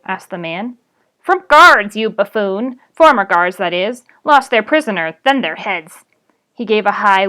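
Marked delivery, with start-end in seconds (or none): 1.2-6.0 loud, mocking goblin insult; goblin scorn. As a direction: sneer loudly at the buffoon. Goblin insults the ditch digger.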